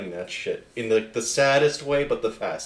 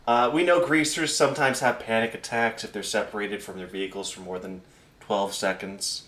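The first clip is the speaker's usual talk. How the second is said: ordinary delivery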